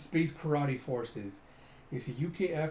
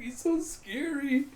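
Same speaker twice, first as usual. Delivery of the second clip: high, slow mock whimper; hammed-up fright in a high voice; scared onlooker; 11 semitones higher, slower than usual. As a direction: squeak out your fear.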